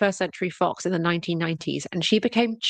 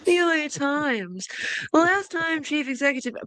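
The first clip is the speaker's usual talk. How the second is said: posh British voice; 8 semitones higher than usual. Pretend. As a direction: stretch out The L A Times in a hammed-up posh British accent. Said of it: "You gotta say, like".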